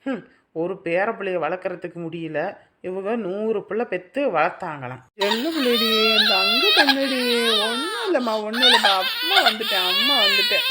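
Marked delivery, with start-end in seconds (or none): 5.3-10.6 high-pitched cooing over the crying; soothing baby talk. Acted. Mother comforts her wailing baby, high cooing. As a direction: reassure the baby in a raised, high voice.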